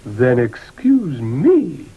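slow drawn-out hat voice; talking hat. Acted. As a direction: draw it out slowly as the hat. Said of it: "said the hat".

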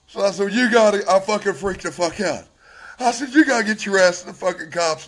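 high, loud, agitated retelling; freaked out. Real, not acted. Husband telling wife to go to cops, freaked out. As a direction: urge it loudly, still shaken.

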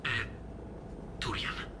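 hushed secret whisper. Acted. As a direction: whisper it so nobody hears. Confidential reveal about the bomb.

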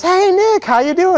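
high, bright friendly hello; cheery greeting. Acted.

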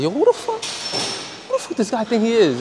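high, indignant mock outburst; mock outraged yell. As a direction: play his own outraged reaction. Retells yelling at unseen dad.

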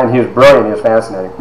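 usual speaking voice

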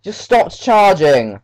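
high, loud frustrated outcry; panicking gamer. Real, not acted. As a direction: yell at the game, dragging it out. Character stops charging.

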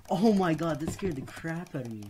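loud, high startled yell; startled shout. Real, not acted. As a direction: yell it out after a fright. Jump scare, died in game.

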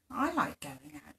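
soft, barely voiced little voice; small hushed voice. A narrator's reading. Unseen sock pipes up from nearby, hushed. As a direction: say it timidly quiet, half-whispered. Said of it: "said a voice".

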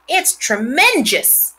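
slow, high drawn-out awe; a hint of boyish wonder; awed boy. A narrator's reading. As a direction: stretch it out in amazement.

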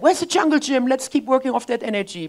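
put-on high, loud eager child calling out; excited kids. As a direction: granddaughters still bursting with energy. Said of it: "They're like".

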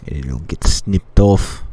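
slow, loud Australian thug; Australian accent. Acted. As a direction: drawl the threat in an Australian accent. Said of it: "I don't know why I went to Australian".

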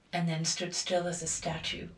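plain reading voice

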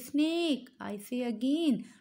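ordinary talk

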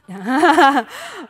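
a loud laugh bursting out; loud burst of laughter. Real, not acted. Preacher laughing at his own programming joke, loud laugh.

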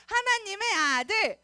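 loud, high, drawn-out chant; loud call-out. Shown as a demonstration. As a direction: lead the children in shouting it. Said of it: "누구 목소리가 큰지 따라해보겠습니다". (크게 늘여서 선창하기)

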